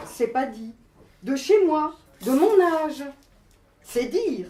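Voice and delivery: emphatic, rhythmic poetry performance in short phrases; poem recital. Acted. Poet reads her self-portrait to the audience, emphatic recital. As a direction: declaim it phrase by phrase, punching key words.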